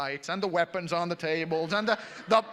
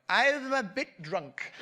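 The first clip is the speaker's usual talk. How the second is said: put-on slow, higher, loose drunk drawl; drunk friend; 7 semitones higher, 6 dB louder, much slower than usual. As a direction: play the tipsy friend.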